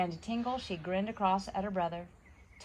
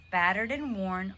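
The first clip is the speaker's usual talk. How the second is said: ordinary delivery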